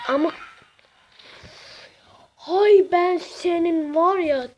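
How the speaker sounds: ordinary talk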